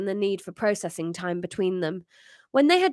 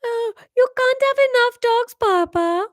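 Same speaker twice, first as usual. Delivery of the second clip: put-on high, loud French child; yawning child's protest; 6 dB louder than usual. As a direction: Bob protesting to his father in a French child voice. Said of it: "said Bob, yawning".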